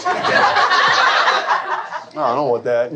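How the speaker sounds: very slow, baffled; slow, confused. Retold or imitated. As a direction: drag the words out, lost. Slow bewildered reply.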